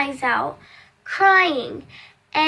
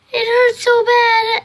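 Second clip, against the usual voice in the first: higher, louder, slow wail; mock sobbing; 8 semitones higher, 7 dB louder than usual. Girl in the car tells of her pain, slow and sobbing.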